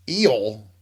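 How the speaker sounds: "Eel" with a long ee for "ill"; Southern "ill". Retold or imitated. Manager puzzled by Donna's word, echoing Southern "Eel" with long ee. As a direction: say "Eel" with a stretched ee, falling away. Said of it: "Why did you have to say eel?".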